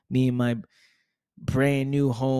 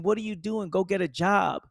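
high, nagging questions, pitched up; doubting relatives; 6 semitones higher than usual. Retold or imitated. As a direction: nag him about getting a job. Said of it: "everybody around me saying".

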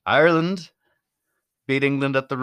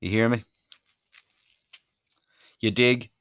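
mock slang. Shown as a demonstration. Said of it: "Who, who even says that anymore?".

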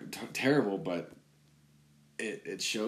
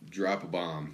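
usual speaking voice

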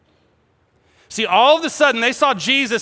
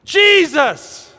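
high, loud desperate cries; acted panicked shouting; screaming for help; 10 semitones higher, 6 dB louder, much slower than usual. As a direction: cry out in terror to be saved. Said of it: "And they start screaming".

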